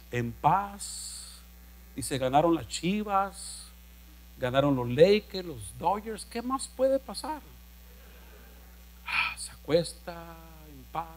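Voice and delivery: softly, drifting off to sleep; drowsy hush. Retold or imitated. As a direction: let the voice sink as if falling asleep. Describe quedarse dormido. (susurro adormilado, cada vez más bajo)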